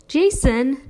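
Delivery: protesting. A narrator's reading. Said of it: "Dad protested".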